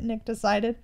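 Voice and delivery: usual speaking voice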